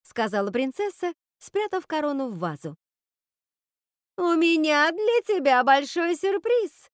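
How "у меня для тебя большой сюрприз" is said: high, slow, loud sugary lilt; sweet sing-song. A narrator's reading. Witch promises Rapunzel a surprise, sweet sing-song. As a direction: sing it out sweetly, drawing the words out. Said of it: "сладко пропела ведьма".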